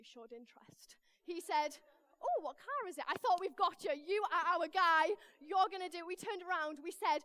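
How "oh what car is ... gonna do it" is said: loud, raised burst of excitement; hammed-up delighted relief, pushed loud; retold eager hope. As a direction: pounce on the one willing helper. Hopeful reaction to interested mechanic.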